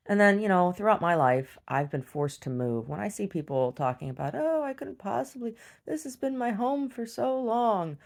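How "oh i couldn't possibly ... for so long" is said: high, slow mock-whiny voice; exaggerated simpering impression; mocking whiner. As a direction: parody a self-pitying homeowner. Mocking people who won't move.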